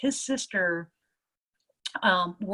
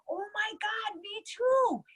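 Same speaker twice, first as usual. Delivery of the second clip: very high gushing squeal; slower than usual. Retold or imitated. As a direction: gush it out way up high. Other guy gushes in agreement.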